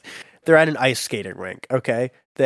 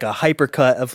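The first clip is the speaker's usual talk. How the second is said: usual speaking voice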